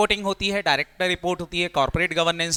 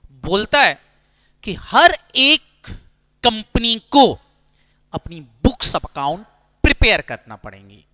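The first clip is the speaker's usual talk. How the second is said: much louder, slow, every phrase hit; emphatic rule; 3 semitones higher, 9 dB louder, slower than usual. Shown as a demonstration. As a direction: lay down the law to the students. Teacher stresses the rule. (ज़ोर देकर, रुक-रुक कर ऊँची आवाज़ में)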